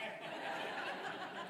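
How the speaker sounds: a soft breathy laugh; real laugh. Real, not acted. Preacher amused at the pastor tapping again, laughing. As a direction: chuckle at the absurd image.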